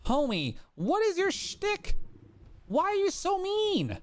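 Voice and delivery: high, whining complaint; whiny plea. Real, not acted. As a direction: whine at the mammoth.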